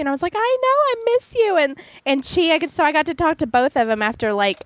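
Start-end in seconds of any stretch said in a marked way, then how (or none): 0.4-1.6 retold gushing reply. Retelling own fond reply to friend.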